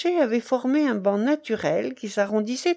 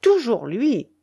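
raised, louder, angry exclamation; hard voice; 4 semitones higher, 5 dB louder, slower than usual. A narrator's reading. The baron resents his rival Willem, in a hard voice. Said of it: "revêtu un timbre si dur".

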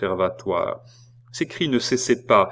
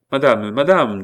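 urgent call; 4 semitones higher, 8 dB louder than usual. Acted. Jeanne calls the concierge urgently.

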